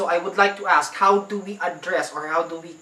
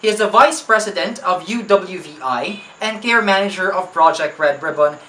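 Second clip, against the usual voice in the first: loud, upbeat announcer welcome; radio host introduction; 2 semitones higher, 7 dB louder than usual. Acted. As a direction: present the guest like a show host. Host introduces guest on show.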